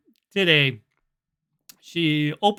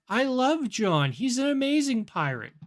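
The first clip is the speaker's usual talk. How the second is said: mock gushing, pitched up; gushing chatbot; 8 semitones higher than usual. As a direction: imitate an adoring chatbot, higher voice. Voicing chatbot gushing over John.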